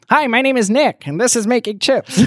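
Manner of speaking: very high-pitched self-mocking intro; comically high voice. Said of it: "It's hard to have a deep voice like that. I have a".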